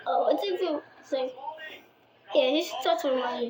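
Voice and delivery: ordinary talk, nothing marked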